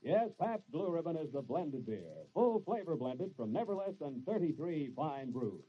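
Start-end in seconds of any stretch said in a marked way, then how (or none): none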